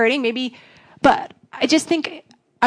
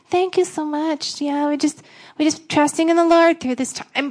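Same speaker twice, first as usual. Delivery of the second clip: higher, slow, polite church-lady thanks; put-on graciousness; 4 semitones higher than usual. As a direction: give a sweetly dutiful, higher-pitched thank-you. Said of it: "have to kinda be somewhat graceful".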